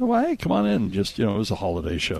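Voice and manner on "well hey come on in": bright high welcome; cheery invite. Retold or imitated. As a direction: call them in, high and friendly. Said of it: "So I said".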